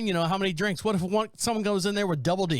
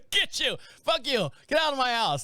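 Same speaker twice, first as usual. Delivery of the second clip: higher, feisty little-dog voice; voicing the dog; 5 semitones higher than usual. Host as the chihuahua, cursing at the coyote. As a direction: play the chihuahua telling off the coyote.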